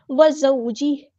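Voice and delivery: slow melodic tajweed chant of व ज़ौजी; Quran recitation. Shown as a demonstration. Teacher models the verse for students, chanting.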